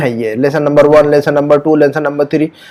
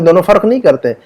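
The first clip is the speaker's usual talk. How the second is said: usual speaking voice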